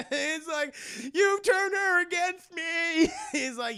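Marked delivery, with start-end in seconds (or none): 1.1-3.1 slow high accusation. Retold or imitated. Anakin accusing Obi-Wan, acted.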